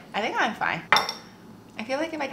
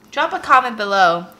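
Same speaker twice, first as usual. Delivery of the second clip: louder, slower viewer call-out; 10 dB louder, slower than usual. Real, not acted. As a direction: call out to the audience. Addressing viewers.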